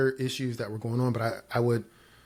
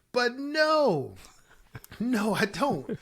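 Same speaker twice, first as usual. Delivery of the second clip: high, drawn-out comic "no"; mock your own stubbornness up high; self-mocking refusal; 13 semitones higher, much slower than usual. Mocking his own past stubbornness.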